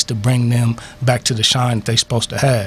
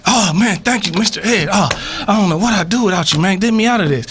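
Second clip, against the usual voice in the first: higher-pitched, gushing thanks to a rescuer; relieved pleading; 6 semitones higher than usual. Acted. Stranded bird thanking Mister Ed for coming, relieved pleading. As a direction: plead gratefully, voice raised in pitch.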